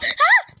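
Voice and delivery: sudden high loud shriek; scream. Real, not acted. Gamer suddenly scared, screaming. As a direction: genuine startled scream.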